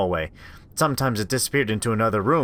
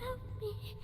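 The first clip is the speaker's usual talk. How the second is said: very high, thin and far-off plea; eerie child voice; 20 dB quieter than usual. Acted. Creature mimicking the little sister, eerie. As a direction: sound like a ghost of a child.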